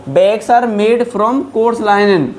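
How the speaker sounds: reads the English example very slowly; slow dictation. Shown as a demonstration. Teacher to Hindi-speaking learners, slow dictation pace. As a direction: give every word its own space.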